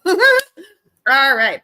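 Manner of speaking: yelping after burning the tongue on tea; just scalded the tongue, laugh it off; burnt tongue. Real, not acted. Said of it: "that was my tea and I burnt my tongue".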